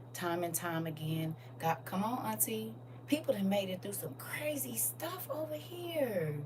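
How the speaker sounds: ordinary talk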